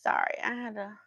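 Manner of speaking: whispered apology. Real, not acted. Mom whispering an apology to viewers after an interruption. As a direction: hush it out.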